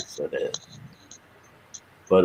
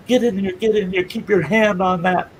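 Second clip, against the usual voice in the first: raised, urgent orders; panicked cop; 10 semitones higher than usual. Retold or imitated. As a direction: hustle the injured kid along in alarm. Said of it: "he's in a panic".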